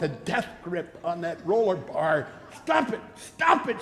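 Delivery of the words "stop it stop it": high, mock-panicked mother's plea; mother pleading. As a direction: play the scared mother on the Ferris wheel, much higher. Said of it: "she'd say".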